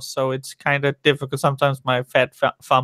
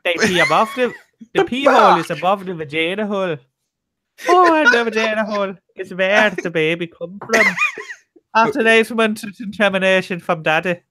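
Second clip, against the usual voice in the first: a put-on oddball Scottish-sounding accent, pitched much higher in spots; weird Scottish-ish voice; 5 semitones higher than usual. Voicing the man on the playground, weird Scottish-sounding voice. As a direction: go goofy and strange, half-Scottish. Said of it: "how do you get the fact that he's Scottish?".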